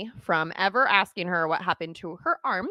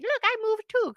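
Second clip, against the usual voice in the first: put-on little kid voice. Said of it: "and was like".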